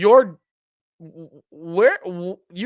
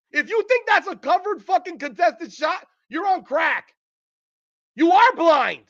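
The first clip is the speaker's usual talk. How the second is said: heated rant; 5 semitones higher than usual. Real, not acted. To fans who blame the defense, a heated angry rant. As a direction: tear into the critics. Ranting at critics.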